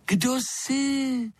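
very high, slow creature squeak; thin as a baby bird's cheep, with a cutting edge; drawn-out high piping voice; thin little voice. A narrator's reading. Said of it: "hlásek tenký jako pípot ptačího mláděte".

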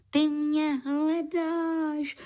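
high, slow astonished question; surprised. Acted. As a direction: ask it in drawn-out surprise. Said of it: "zvolal překvapeně". (překvapeně, vysoko a pomalu)

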